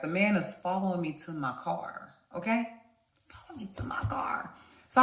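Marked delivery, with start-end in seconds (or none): 3.3-4.5 hushed aside; conspiratorial whisper to the audience. Real, not acted. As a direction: drop to a whisper for emphasis. Whispered aside about him following.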